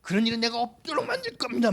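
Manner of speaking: a flustered disciple arguing with his teacher; worked-up Peter. Retold or imitated. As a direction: act Peter hot-headed and protesting. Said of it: "우욱해가지고".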